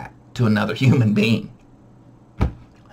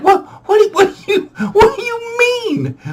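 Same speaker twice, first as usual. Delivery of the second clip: very high, cracking squeak of panic; panicked high squeal through laughter; laughing panic. Retold or imitated. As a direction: sputter in fake terror. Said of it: "I'm like".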